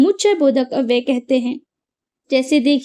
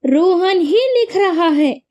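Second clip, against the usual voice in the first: slow, with ही raised and pressed; stress on ही; 4 semitones higher than usual. Shown as a demonstration. Teacher demonstrating emphasis word ही, slow stress. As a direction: lean on ही, say it slowly. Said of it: "यहाँ पे बल दिया है".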